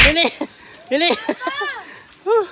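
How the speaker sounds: panting after the swim race; words broken by heavy breathing. Real, not acted. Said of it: "Hiningal kami". (hingal na hingal)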